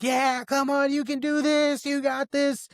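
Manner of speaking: high-pitched cheer without extra volume; imitating cheering crowd. Crowd to runners near the finish line, cheering. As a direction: cheer them on in a high, held-up voice. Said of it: "Everyone's cheering you on".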